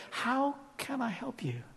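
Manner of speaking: slow, hushed tender question; very soft, unvoiced-sounding invitation to talk; gentle whisper. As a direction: whisper it slowly as an intimate offer. Voicing Jesus's gentle question.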